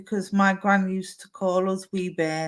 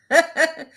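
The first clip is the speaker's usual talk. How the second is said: short laugh; 8 semitones higher, 4 dB louder than usual. Real, not acted. Short laugh after message.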